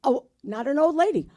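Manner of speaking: ordinary talk, nothing marked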